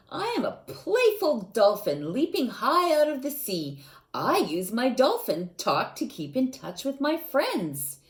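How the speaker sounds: bright storybook read-aloud voice; lively read-aloud for young listeners; reading to children. Acted. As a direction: read it like a picture book to little kids. Teacher reads a children's book aloud.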